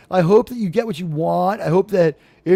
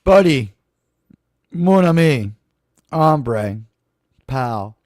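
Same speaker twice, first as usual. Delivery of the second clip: sincere thanks; 5 semitones lower than usual. Real, not acted. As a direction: drop down and thank him from the heart. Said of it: "I need to compose myself".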